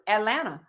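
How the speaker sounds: "Atlanta" with no second T; soft "Atlanta", the last T gone; dropped T. Shown as a demonstration. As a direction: say "Atlanta" as At-lanna. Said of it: "With no T".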